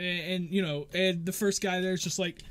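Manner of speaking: ordinary delivery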